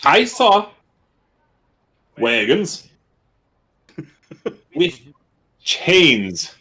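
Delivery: halting words, each in a different put-on voice; many different voices. Bird character explaining wagons and prisoners, switching voices word by word. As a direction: piece it together from borrowed voices, with long pauses. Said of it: "trying so many different voices in ten seconds".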